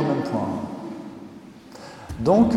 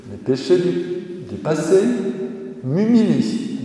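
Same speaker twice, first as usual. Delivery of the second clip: quoting Thérèse; 5 semitones higher than usual. Retold or imitated. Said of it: "dit-elle".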